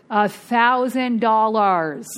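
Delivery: loud, slow, drawn-out THOUSAND DOLLARS; amazed emphasis. Real, not acted. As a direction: stretch out THOUSAND and DOLLARS loudly. Repeats the sum in amazement.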